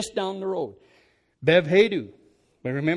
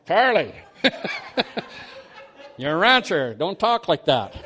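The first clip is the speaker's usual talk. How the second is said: loud, laughing through a teasing scolding; laughing at a friend who talks like a mystic; laughing rebuke; 4 dB louder than usual. Real, not acted. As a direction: chuckle while you tell him off.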